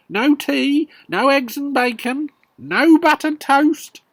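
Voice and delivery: put-on loud, high, furious old woman; shouting grandma. Grandma shouting at the three people below her. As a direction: bellow it down from a great height. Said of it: "and she shouted".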